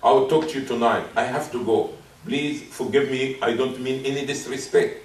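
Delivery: low, softened apology; bow out gently and respectfully; nice, polite way. Acted. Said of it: "but in a nice way".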